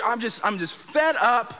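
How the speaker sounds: ordinary talk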